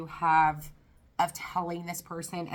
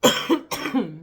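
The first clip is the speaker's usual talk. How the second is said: coughing; 5 semitones higher, 10 dB louder than usual. Real, not acted. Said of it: "I'm trying not to cough".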